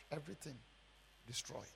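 low, very soft echo of ruin; quiet, broken up by a pause; hushed aftermath. Retold or imitated. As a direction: let it sink away, word by word. Repeats the ruin, now hushed.

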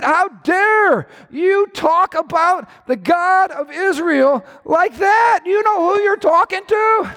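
indignant rebuke. Acted. David rebuking Goliath's blasphemy.

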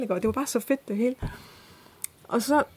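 normal talking voice

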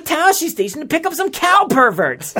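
put-on high whining farm boy; whiny Luke Skywalker. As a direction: whine it like a bored teen. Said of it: "Aunt Beru".

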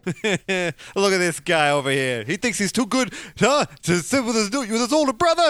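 put-on teasing older brother.

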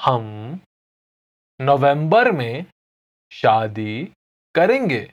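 हम, नवंबर में, शादी, करेंगे with pauses; slow Hindi drill; slow, word by word. Shown as a demonstration. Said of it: "I'll say it again slowly".

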